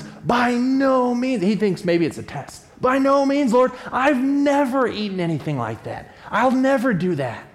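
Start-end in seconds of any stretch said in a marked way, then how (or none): 0.0-1.4 slow, drawn-out, higher refusal; stunned Peter drawing out the refusal; Peter refusing. Retold or imitated. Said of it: "His response is". 2.8-7.4 higher, insistent protest; Peter protesting. Retold or imitated. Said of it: "His response is".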